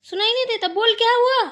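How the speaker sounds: very loud, high demand; angry yelling. Acted. Mother-in-law unheard, demanding what happened, angry yelling. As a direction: bellow impatiently for an answer. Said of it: "गला फाड़ फाड़ चिल्ला रही हूँ".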